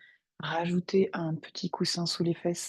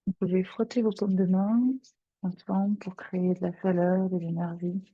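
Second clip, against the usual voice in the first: guided meditation; 4 dB louder than usual. Acted. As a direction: guide them gently into their breath. Guide une méditation. (voix de méditation guidée, calme et posée)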